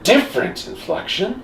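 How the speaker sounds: "Different" pitched high, rising at the end; incredulous echo. Shown as a demonstration. Friends parodying Solid Snake's repeats, high questioning echo. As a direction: punch "Different" high, then ask it. Echoing the line in turns.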